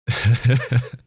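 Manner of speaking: high, loud villain cackle; a mocking laugh at the captive's hope of choosing; sinister laugh. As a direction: cackle with cruel amusement.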